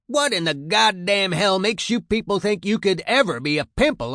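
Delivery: loud, high sergeant's harangue; disgusted tirade. A narrator's reading. Sergeant looks over the new recruits, loud disgusted berating. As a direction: tear into them with open disgust. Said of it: "he looks disgusted at what he sees".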